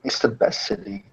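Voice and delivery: dry, mocking praise; sarcastic. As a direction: say it like you mean the opposite. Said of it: "as he says sarcastically".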